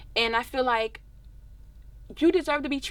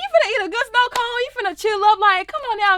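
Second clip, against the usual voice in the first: much higher and louder, come on now energy; exasperated excitement; 10 semitones higher, 9 dB louder than usual. Real, not acted. Lecturing the angry girl who had a snow cone, exasperated. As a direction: hype it up, can't believe her.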